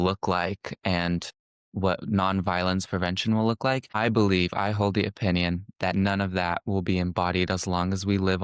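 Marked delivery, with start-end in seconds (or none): none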